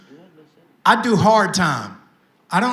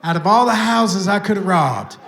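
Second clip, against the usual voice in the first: usual speaking voice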